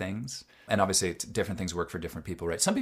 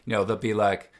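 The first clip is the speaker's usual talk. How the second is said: ordinary talk, nothing marked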